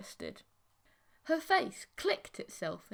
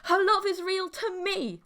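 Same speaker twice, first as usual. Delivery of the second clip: loud, much higher cry of protest; tearful shout; 10 semitones higher, 7 dB louder than usual. Acted. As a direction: shout it near tears. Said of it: "I shouted".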